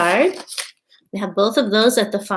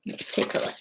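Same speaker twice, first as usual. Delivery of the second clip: hushed whisper before the reveal; soft conspiratorial whisper; 10 dB quieter than usual. Acted. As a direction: whisper it like a secret. Teacher peeking inside seed packet.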